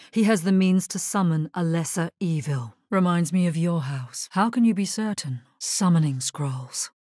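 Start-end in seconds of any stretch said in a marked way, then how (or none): none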